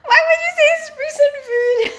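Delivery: high, loud laughing protest; laughing outrage. Real, not acted. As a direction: squeal it in mock offence, half laughing. Viewer calls crumpets prison food.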